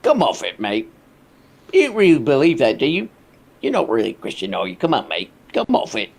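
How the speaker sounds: a British dad telling his son to come off it; mock British bloke. Reactor imitating Piers scoffing at Russell in a British accent. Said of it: "basically Piers Morgan going".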